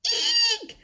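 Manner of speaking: shrill eek of fright; high squeak. A narrator's reading. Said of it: "cried the three bears".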